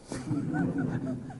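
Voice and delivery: airy high chuckle; high breathy laugh. Real, not acted. Preacher laughs at his own old arrogance, high and breathy.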